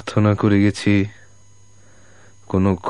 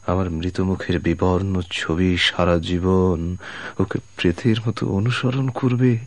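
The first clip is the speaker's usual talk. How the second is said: normal talking voice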